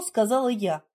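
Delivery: ordinary delivery, nothing marked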